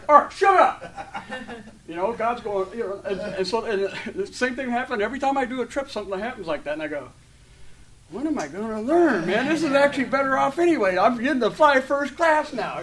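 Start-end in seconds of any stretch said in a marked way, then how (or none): 0.1-1.2 high, slow and laughing, mock stern shut up; God telling him off. God's voice in the story. 8.1-12.8 higher and much louder, thrilled; excited realization. Retold or imitated. His own realization, retold.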